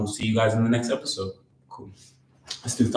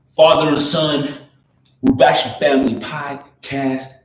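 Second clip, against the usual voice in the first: slow, raised American-accented podcast intro; 4 semitones higher, 3 dB louder, slower than usual. Real, not acted. Son opens the family podcast in an American accent. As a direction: announce it slowly and higher in an American accent. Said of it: "Why am I talking in American accent".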